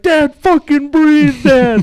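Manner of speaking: very high, slow, loud screaming plea; hammed-up kid shrieking at dad; retelling son screaming at his frozen dad, frantic yell. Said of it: "He's like".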